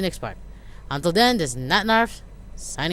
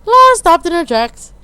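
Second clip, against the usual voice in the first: loud; 6 semitones higher, 12 dB louder than usual. Acted. Louder high read of scene.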